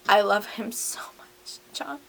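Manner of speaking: breathy whisper, no voice at all; whispered confession. Acted. Hamilton confides to his boyfriend in a whisper. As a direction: whisper it like a secret love note. Hamilton's love letter to John.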